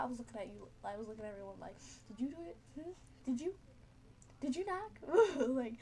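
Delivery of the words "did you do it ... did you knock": suspicious questioning. Retold or imitated. Accusing friends during seance.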